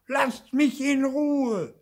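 high, loud, drawn-out yell; long, full-out child's shout; furious bellow. A narrator's reading. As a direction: bellow to be left alone. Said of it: "brüllt Theo".

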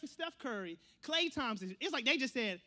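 normal talking voice

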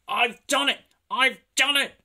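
high, loud triumphant cry; panted triumph. A narrator's reading. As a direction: shout it at the top of the climb. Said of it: "he panted".

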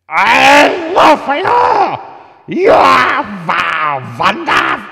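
put-on high, very loud screech with a Russian accent; drawn-out feline shriek, put-on Slavic accent; Russian screaming cat. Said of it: "some sort of Russian screaming cat".

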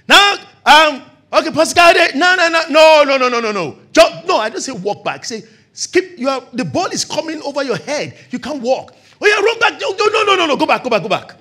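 loud, high drill calls; coach calling drills. Retold or imitated. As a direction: drive the player around the court.

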